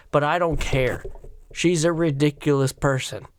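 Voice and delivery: usual speaking voice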